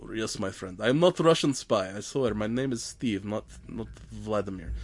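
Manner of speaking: playful fake Russian accent; thick Russian accent, lightly hammed. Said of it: "did you just turn Russian?".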